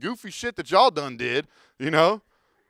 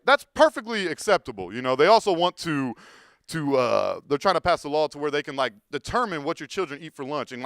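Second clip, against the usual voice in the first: ordinary delivery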